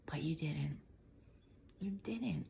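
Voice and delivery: soft real whisper; whispered encouragement. Real, not acted. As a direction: breathe it as a whispered secret. Intimate reassurance to viewer.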